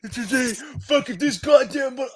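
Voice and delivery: high, raspy put-on voice; Bubba voice impression. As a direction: do the YouTuber's gruff voice. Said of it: "I can only do his voice for so long".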